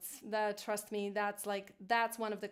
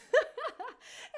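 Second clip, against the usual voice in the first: high, amused laugh; high-pitched giggle; 12 semitones higher than usual. Real, not acted. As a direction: laugh at your own silly voice. Laughs after silly head-voice demo.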